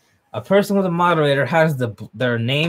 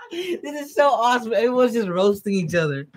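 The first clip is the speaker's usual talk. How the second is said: high-pitched gleeful reaction; thrilled, high-voiced hype; delighted excitement; 9 semitones higher than usual. Real, not acted. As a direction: gush high, fresh off a laugh. Delighted by characters roasting each other.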